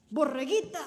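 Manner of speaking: llama a Borreguita fuerte; grito. Acted. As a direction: gritando su nombre desde el matorral. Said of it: "gritó". (Coyote grita a Borreguita)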